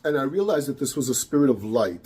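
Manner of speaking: normal talking voice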